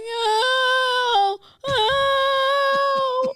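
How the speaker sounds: a child hammed up rolling down a hill; mock pained ow. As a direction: whimper out the ows like a hurt kid. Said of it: "I'm going".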